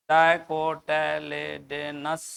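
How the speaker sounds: Dicotyledonous drawn out slowly, syllable by syllable; slow term. Shown as a demonstration. Teacher spelling out the term for students, slow and deliberate. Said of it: "डाइकोटिलेडोन समझता है".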